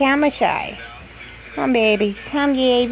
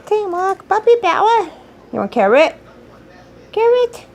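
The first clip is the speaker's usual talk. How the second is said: excited dog talk; 6 semitones higher, 4 dB louder than usual. Real, not acted. Cheering the dog.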